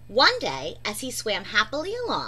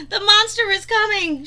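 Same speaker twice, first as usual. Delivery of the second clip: much higher, louder shriek; 9 semitones higher, 7 dB louder than usual. A narrator's reading. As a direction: shriek it in panic. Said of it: "shrieked Percy".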